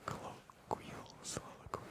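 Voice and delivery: "Colloquial soliloquy" whispered softly; ASMR whispered tongue twister. Shown as a demonstration. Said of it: "kind of a tongue twister".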